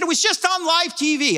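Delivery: alarmed high voice; excited. Retold or imitated. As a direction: pastor blurting it out. Pastor exclaims on phone.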